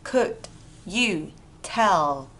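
each word alone between pauses; slow dictation for learners. Shown as a demonstration. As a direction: separate "Could", "you" and "tell". Teacher models words one by one.

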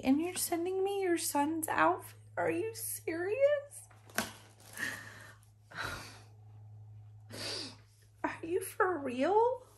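high, disbelieving, overcome; can't believe what she's holding; stunned disbelief. Real, not acted. Moved by gift of son's outfit.

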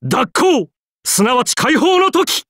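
high-pitched, exultant cry; triumphant proclamation. Acted. Writer announcing the moment of release, triumphant cry.

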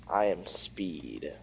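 slow, low meme voice; movie quote. Pretend.

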